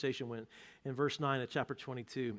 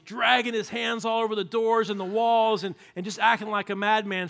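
raised, loud, high preaching burst; loud storytelling; 7 semitones higher, 10 dB louder than usual. A narrator's reading. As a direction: tell the madness at full force. Preacher acting out madman scene.

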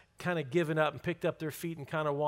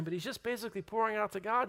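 ordinary talk

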